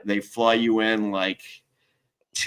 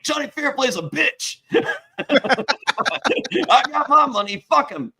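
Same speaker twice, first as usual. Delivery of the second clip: high, laughing wrestler impression; half-laughing Big Papa Pump bit; mock Scott Steiner; 12 semitones higher, 3 dB louder than usual. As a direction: ham up a pumped wrestler, pitched way up. Impersonating Scott Steiner on video.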